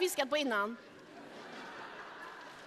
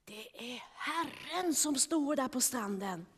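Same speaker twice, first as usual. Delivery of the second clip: hushed, much softer, lower; whisper; 4 semitones lower, 12 dB quieter than usual. Acted. John telling Peter in awe that it is the Lord, whispered. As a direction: breathe it in awe. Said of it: "och viskade".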